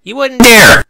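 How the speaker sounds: filly shouting back at her mother; indignant cry. A narrator's reading. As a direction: yell it from behind the door. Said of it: "cried Sparkler from indoors".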